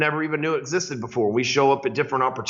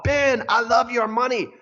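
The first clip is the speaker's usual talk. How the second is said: calling out; 8 semitones higher than usual. Retold or imitated. Calling out to Ben Franklin.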